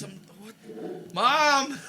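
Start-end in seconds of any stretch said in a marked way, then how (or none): 1.1-1.8 mock call for mom.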